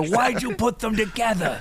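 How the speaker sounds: high, slow mock outrage; slow high mock dismay; mock exasperated official. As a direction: play the baffled warden. Mock outraged movie line.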